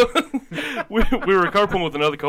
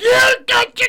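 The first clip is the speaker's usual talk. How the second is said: much higher, much louder yelled quote; mock furious scream; 9 dB louder than usual. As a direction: scream it at the driver who cut you off. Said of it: "I just screamed".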